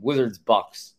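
ordinary talk, nothing marked

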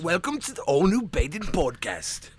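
slow, hammed-up British accent attempt; bad British accent. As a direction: fake a British podcast host, badly. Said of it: "Can you do a British voice?".

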